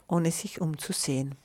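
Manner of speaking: usual speaking voice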